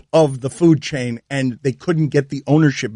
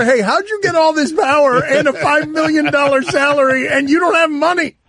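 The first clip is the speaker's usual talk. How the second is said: mocking high voice; 11 semitones higher than usual. Mock text to Jeter, high.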